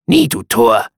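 loud, abrupt raven's cry; harsh raven croak. A narrator's reading. Raven answers the brooding narrator, harsh and loud. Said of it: "Seine schroff gesprochenen Laute".

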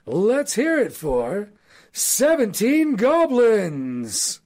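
high, slow, booming fight announcer; ring announcer. Acted. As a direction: stretch it out like a boxing MC hyping the crowd.

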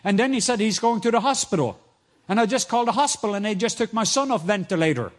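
high, loud, breathless news; desperate loud report. Retold or imitated. Imam telling the coordinator his son is freed, loud high urgent voice. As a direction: blurt it out like a shaken father.

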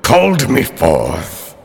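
booming genie voice; proud spirit addressing summoners; grandly granting a wish. Acted. GM plays genie.

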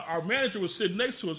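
normal talking voice

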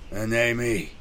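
dying man gasping out words; gasping, cracking voice. A narrator's reading. Dying sergeant to the apothecary, gasping with effort. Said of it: "words came in gasps, his normal, rich, deep voice cracking with the effort".

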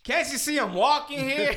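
high, loud New York "walking here" line through a laugh; Brooklyn accent. Retold or imitated. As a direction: play a cocky New Yorker, laughing. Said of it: "busted out the fucking Brooklyn accent".

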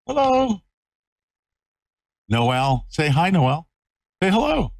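high, sing-song puppy talk. Real, not acted. Greeting new puppy on camera.

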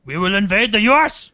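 high, loud mock declaration of war; ISIS threat. ISIS threatening America in a joke script, mock menace. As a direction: proclaim it like a hammed-up villain.

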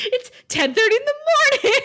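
high, fast, huffy; indignant Giles. Retold or imitated. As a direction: squeak out a scandalised scolding. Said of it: "He's like".

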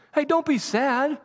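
high, gentle reassuring voice, a touch hammed-up; playful imitation of a gentle consoler; mock comforting angel. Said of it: "That's not what he does".

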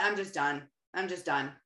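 low, drained mock resignation; lightly voiced worn-out defeat; defeated giving up. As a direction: sound like you've quit. Retold giving-up self-talk.

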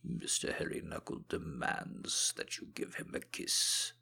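soft whispered voice for a hairy knuckle; playful whispered hand puppet voice. Pretend. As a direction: speak for the knuckle in a hushed whisper. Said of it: "Give the hair a kiss".

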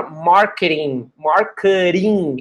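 "marketing" said slowly, the t as a quick tapped r, like "marketing" → "marker-ing"; marketing with a flapped t. Shown as a demonstration. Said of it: "é tipo um R aqui, não um T". ("marketing" com o t soando como um r rápido)

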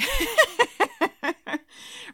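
long high giggle. Real, not acted. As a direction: giggle at the silliness. Laughs after quote.

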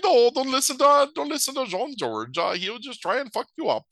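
high-pitched put-on woman's voice; Icelandic lady.

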